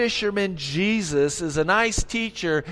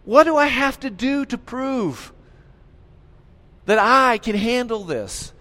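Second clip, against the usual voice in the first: eye-rolling Jesus; 6 semitones higher than usual. Acted. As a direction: sigh it out, then build to a challenge. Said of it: "I think he rolled his eyes".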